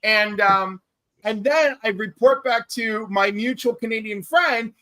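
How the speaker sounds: ordinary delivery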